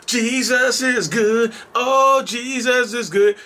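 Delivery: slow, high, drawn-out pious voice; mock churchgoer. Mocking a fake churchgoer, slow high pious praise. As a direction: drag out the praise in a mock holy voice.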